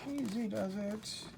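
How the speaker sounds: soft, careful murmur; hushed concentration on a delicate job; careful coaxing. Real, not acted. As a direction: coax it along gently and quietly. Carefully opening the packaging.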